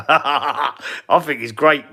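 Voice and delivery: slow words through a laugh. Real, not acted. Praising the streamer to Frank, laughing.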